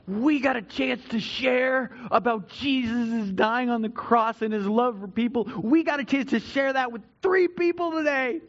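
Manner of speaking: excited believers. Retold or imitated. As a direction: imitate believers thrilled to have shared. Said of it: "they're so excited about the message".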